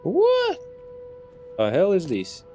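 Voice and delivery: ordinary talk, nothing marked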